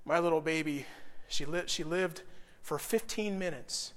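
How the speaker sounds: soft, low, halting sorrow; quietly, with pauses to hold it together; subdued grief. Real, not acted. Father telling congregation of his baby's short life, real grief.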